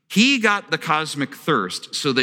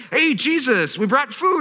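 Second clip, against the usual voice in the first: cheery disciples call; 9 semitones higher than usual. Retold or imitated. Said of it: "they're like".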